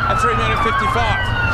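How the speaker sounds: loud, high, urgent bid call. Real, not acted. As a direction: push the room with a loud call. Auctioneer urging final bids.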